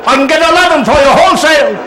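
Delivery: high, haggling Yiddish merchant; Yiddish dialect. Acted. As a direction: bargain hard in a comic Yiddish accent. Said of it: "they had picked some other dialect".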